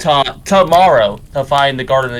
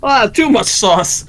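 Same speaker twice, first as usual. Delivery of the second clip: slow, higher, hammed-up Italian accent; 7 semitones higher, much slower than usual. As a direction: drag it out like a cartoon Italian. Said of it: "In an Italian accent".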